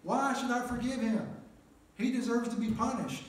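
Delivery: high, loud, indignant shouting at God; a man arguing with God, shouting up at him in indignation; angry protest. Retold or imitated. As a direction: yell your case to the Lord, outraged. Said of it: "I was so angry".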